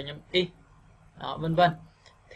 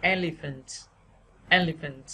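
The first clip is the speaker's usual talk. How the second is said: "Elephant" with raised "E", falling after; stress on first syllable. Shown as a demonstration. Teacher repeating the word for learners, stressed start. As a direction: punch the start of "Elephant", let the rest drop. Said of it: "bây giờ các bạn sẽ đọc nhé".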